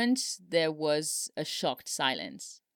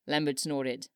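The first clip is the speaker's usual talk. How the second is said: ordinary delivery, nothing marked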